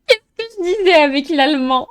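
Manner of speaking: high, loud, fast crowing; exultant I-told-you-so between laughs; triumphant gloating. Real, not acted. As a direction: repeat it gleefully, loud and quick. Repeats the gloat, laughing around it.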